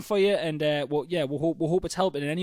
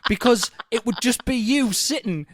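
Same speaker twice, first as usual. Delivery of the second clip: protest, half-laughing; heated; 6 semitones higher than usual. Real, not acted. Defending against mansplaining.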